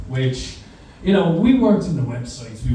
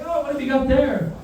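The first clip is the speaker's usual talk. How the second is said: sweet teacher voice; 10 semitones higher than usual. Retold or imitated. Teacher asks kid sweetly.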